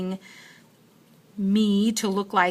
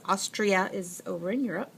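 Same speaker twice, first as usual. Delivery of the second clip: ordinary delivery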